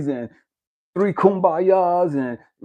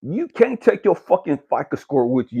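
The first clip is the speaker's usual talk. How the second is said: ordinary delivery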